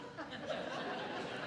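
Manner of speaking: quiet airy chuckle; a soft laugh at his own joke; real quiet laugh; soft breathy laugh. Real, not acted.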